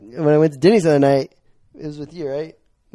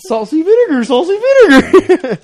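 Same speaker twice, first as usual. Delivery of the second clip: mock shrill call; 13 semitones higher, 6 dB louder than usual. Mocking a call for chips.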